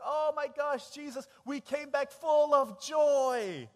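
high, loud, joyful gush; so excited. Acted. Returning disciples gushing to Jesus, overjoyed and loud. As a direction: burst out with it, stretching the words. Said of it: "they're so excited".

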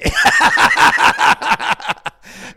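big burst of breathy laughter; loud laugh. Real, not acted. Counselor laughing at her own story, loud.

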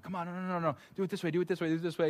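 hammed-up micromanaging, words tumbling in repeats; imitated hovering dad. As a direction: fuss over every move without shouting. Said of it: "I gotta be on top of him".

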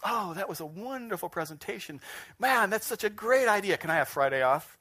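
hammed-up eager gushing; gushing flatterer. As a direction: lay on the flattery to the boss. Acts out brown-noser flattering boss.

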